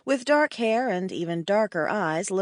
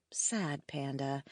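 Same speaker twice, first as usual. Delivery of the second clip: mock sad; 6 semitones lower, 11 dB quieter, much slower than usual.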